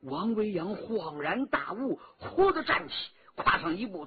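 usual speaking voice

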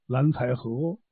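ordinary delivery, nothing marked

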